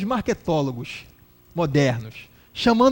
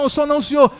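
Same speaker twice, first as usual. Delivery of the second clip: higher, louder imitated answer; mock a pressured churchgoer answering back; raised-voice denial; 6 semitones higher, 5 dB louder than usual. Man answering shouting pastor.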